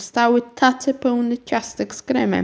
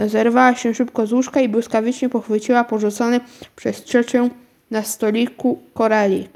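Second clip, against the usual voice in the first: plain reading voice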